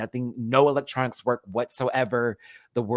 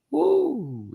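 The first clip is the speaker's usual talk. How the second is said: high Marge woo, higher; Marge Simpson woo; 14 semitones higher, 3 dB louder than usual. Retold or imitated. Mimicking her Marge Simpson text tone, high woo. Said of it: "she does that woo".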